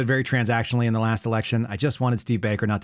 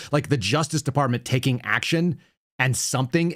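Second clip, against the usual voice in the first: ordinary talk, nothing marked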